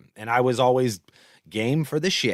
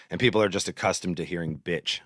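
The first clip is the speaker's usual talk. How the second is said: usual speaking voice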